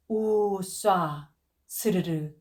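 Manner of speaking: wind whoosh. A narrator's reading. Narrator mimics wind.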